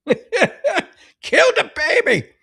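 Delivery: high, very slow mocking drawl; mocking repeat. Host throwing the poster's words back, in a slow high mock. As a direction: stretch it out high in disbelief.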